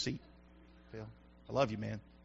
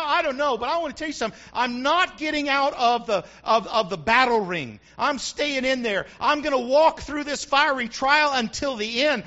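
louder, higher preaching to the church; full-force preaching; 5 semitones higher, 6 dB louder than usual. Acted. Pastor preaching hard to his church, full force. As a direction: preach it out loud to the congregation.

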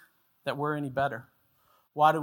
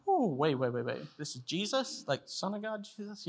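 hushed, rising mock disbelief; incredulous; 8 dB quieter than usual. Preacher voices listeners doubting that Jesus had to learn, incredulous. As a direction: question it softly, pitch climbing in doubt.

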